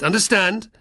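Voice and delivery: loud, sharp high demand; snapped. Acted. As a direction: bark it as a warning. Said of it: "the Apulian snapped".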